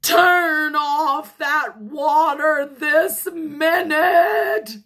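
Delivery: high, loud, slow command; stretched-out, irate holler; scolding shout. A narrator's reading. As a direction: order it at a furious yell. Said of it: "shouted Missus Rogers".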